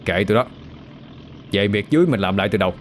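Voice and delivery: quick, dismissive scoff; understated thuggish indifference; cold scoff. A narrator's reading. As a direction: shrug them off with a cold snort. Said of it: "Tài cũng hừ lạnh".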